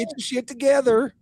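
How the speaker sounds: hammed-up high Southern twang; mock twang. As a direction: chide her in a put-on country twang.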